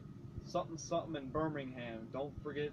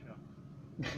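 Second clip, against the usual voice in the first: slow, much softer drawl; movie character line; 5 semitones lower, 7 dB quieter, much slower than usual. Pretend. Quoting a film character to friends, slow and soft. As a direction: drag it out low and slow. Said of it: "What was it?".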